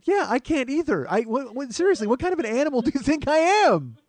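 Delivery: high-pitched, indignant comic rant; mock outrage. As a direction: protest loudly as if deeply insulted. Retold mock outrage at the insult.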